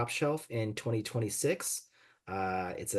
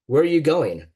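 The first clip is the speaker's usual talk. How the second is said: higher, louder mother calling across the table; 4 semitones higher, 10 dB louder than usual. Acted. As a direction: call after her daughter. Mom calls after leaving daughter.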